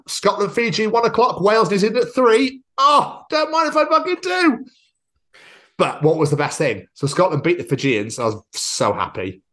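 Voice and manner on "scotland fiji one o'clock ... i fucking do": rising, higher, louder glee; gleeful. Retold or imitated. Planning a day of rugby, building gleeful excitement. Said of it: "so I was like".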